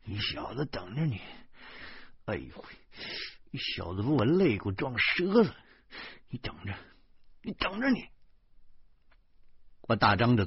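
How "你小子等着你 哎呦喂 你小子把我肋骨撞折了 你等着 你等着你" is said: soft, pained threat through hurt ribs; quiet menacing grumble in pain; low threat. A narrator's reading. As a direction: threaten under your breath while wincing. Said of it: "小声说".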